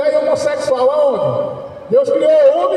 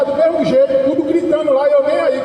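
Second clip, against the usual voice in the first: ordinary delivery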